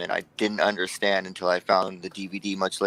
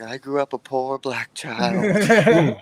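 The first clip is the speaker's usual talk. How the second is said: Steve Martin line; 4 semitones higher, 4 dB louder, much slower than usual. Retold or imitated. Quoting The Jerk.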